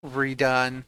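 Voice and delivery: ordinary delivery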